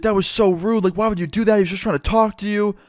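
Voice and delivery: high-pitched mock outraged girlfriend; put-on high voice, scolding in disbelief; freaking-out girlfriend. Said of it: "starts freaking out".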